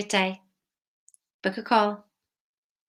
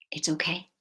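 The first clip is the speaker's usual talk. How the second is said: whisper; 3 semitones lower, 4 dB quieter than usual. Acted. Reassuring viewers, repeated.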